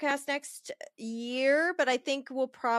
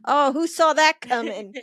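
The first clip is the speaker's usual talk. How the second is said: sarcastic; 3 semitones higher, 8 dB louder than usual. Real, not acted. Self-mocking sarcasm.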